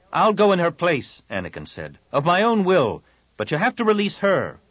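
high, urgent and earnest; urgent offer. Acted. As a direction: plead to take her place. Anakin offers himself.

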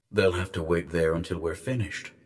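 soft, low, formal address; disembodied guardian voice. A narrator's reading. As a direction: address the envoy quietly from out of sight. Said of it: "told the disembodied voice".

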